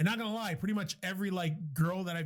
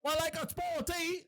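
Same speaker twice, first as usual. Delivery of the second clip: high, hammed-up British accent; mock British accent; 9 semitones higher, 3 dB quieter than usual. Someone with an accent hitting on him, mock British. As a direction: put on a silly high-pitched British chat-up voice. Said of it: "I fucking love accents".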